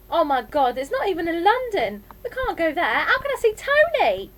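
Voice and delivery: put-on high, loud teenage outrage; dismayed, dramatic outburst; horrified protest. A narrator's reading. Daughter horrified at the move.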